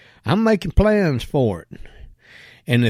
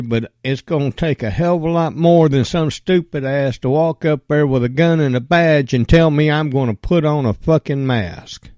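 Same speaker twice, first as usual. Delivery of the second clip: ordinary talk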